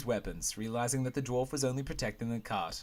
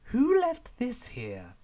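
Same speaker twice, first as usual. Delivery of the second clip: high startled question; 12 semitones higher, 6 dB louder, much slower than usual. Acted.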